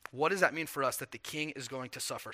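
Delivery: ordinary talk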